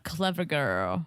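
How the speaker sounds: low, slow movie-line imitation; Jurassic Park quote. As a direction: drop low and draw out the film quote. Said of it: "Oh, that's Jurassic Park".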